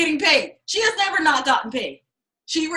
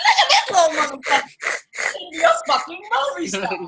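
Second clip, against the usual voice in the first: laughing admiration; 5 semitones higher, 3 dB louder, faster than usual. Retold or imitated. Marvelling at the husband.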